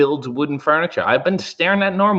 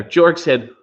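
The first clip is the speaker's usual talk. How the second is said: usual speaking voice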